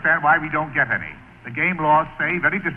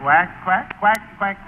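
mock duck quacks, rising; quacking like a duck; 4 semitones higher, slower than usual. Second hunter joins the duck call, mock quacks. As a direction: quack like a duck to lure them in. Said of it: "you have to quack like a duck".

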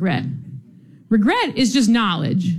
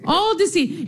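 normal talking voice

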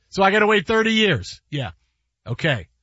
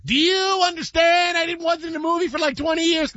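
high-pitched, strident comic shouting; Chris Tucker impression; 10 semitones higher than usual. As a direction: screech it out in a high, stretched comic voice. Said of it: "do a Chris Tucker impression".

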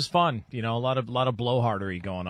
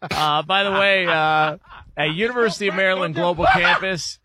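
loud, raised radio ad voice; sponsor read; 8 semitones higher, 8 dB louder than usual. Acted. Host cutting back to the sponsor, loud ad read. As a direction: push the sponsor name out over the laughter.

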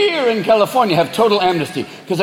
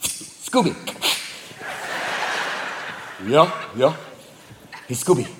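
soft, hushed cat murmur; sly cat voice; 4 semitones lower, 7 dB quieter than usual. Acted. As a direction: keep it low and conspiratorial. Cat whispering to sleeping dog.

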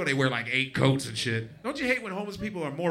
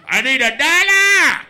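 high, drawn-out loud yell; hollering beggar; 11 semitones higher, 11 dB louder than usual. Retold or imitated. Homeless man begging for a dollar, hollering. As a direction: bellow a plea, stretching each word.

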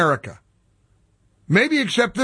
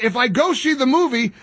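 ordinary talk

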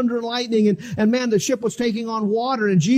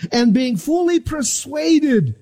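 preaching; 6 semitones higher, much slower than usual. Acted. Preacher proclaiming faith to the congregation. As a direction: build to a climax.

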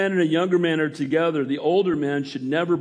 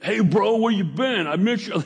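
higher, pushy mock-confrontational greeting; exaggerated, raised-voice version of a friendly text; misread text tone; 5 semitones higher than usual. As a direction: punch it up as if demanding an answer. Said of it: "You never know the tone of voice".

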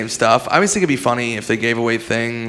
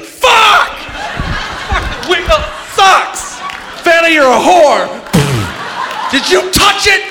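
high, loud raging yell; hammed-up tantrum at Vanna; mock furious rant; 3 dB louder, slower than usual. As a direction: lose it at the wheel, shouting. Imagined rage at wheel.

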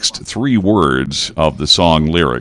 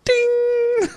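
bell ding; 4 dB quieter than usual. Pretend. Game show correct-answer bell.

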